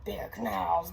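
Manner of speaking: usual speaking voice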